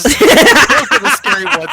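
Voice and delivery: high, loud, cracking with laughter; can't hold the line together for laughing; giggling imitation; laughing through a quote. Retelling funny movie line.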